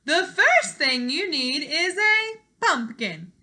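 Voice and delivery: loud, high, slow instruction; cheery fairy godmother. Acted. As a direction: announce it like a jolly fairy.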